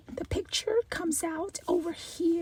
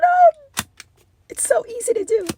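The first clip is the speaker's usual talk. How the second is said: excited; 6 semitones higher, 12 dB louder than usual. Real, not acted. Showing off the new camera.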